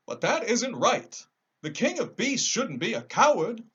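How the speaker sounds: high, loud scarecrow objecting; a straw man protesting; lightly voiced scarecrow.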